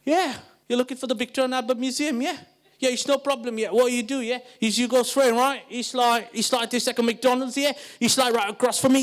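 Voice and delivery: put-on slurred street-London English accent; casual English youth accent, "yeah" tacked on; young English person's accent. Young Englishman giving muddled directions, street-London accent. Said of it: "this young English person".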